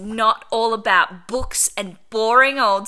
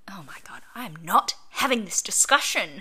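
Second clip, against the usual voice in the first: hissed whisper; whispered aside; 3 semitones lower, 5 dB quieter than usual. Real, not acted. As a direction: whisper it off-mic. Muttered to colleague.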